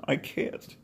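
real tears breaking through the words, voice cracking high; crying. Real, not acted. Vlogger to struggling viewers, crying as he opens up. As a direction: choke the words out through tears, slow and strained. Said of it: "Sorry, I'm crying".

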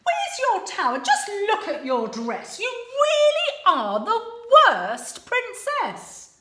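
high, loud telling-off; hammed-up huffy prince; scolding prince. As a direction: scold her sharply. Prince in a huff.

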